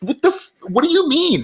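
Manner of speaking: very high squeaky disbelief; mock outrage. Advisor voicing mock disbelief at a customer, very high. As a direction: squeal it in fake shock.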